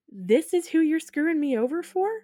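high, incredulous comic outrage; mock indignant disbelief. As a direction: question it as a scorned lover. Jilted woman confronting him, acted.

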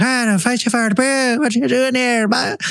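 garbled, put-on Irish accent; hammed-up Irish ghost. Irish ghost startling castle investigators, hammed-up mock-Irish accent. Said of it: "imagine these ghosts being like".

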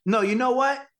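high, urgent imitation of a director; eager director. Director decides to shoot right away, imitated eagerness. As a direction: brush the plan aside and push to roll. Retold director changes his mind.

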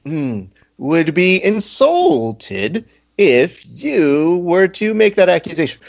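slow, over-enunciated mock indignation, high-pitched; hammed-up formal offence, drawn out; overcompensating proper speech. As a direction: imitate someone trying hard to sound accent-free. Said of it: "that was definitely you going".

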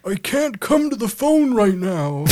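put-on high, loud old man voicemail voice. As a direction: squawk it like an old man's answering machine. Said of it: "Why don't you be the old man?".

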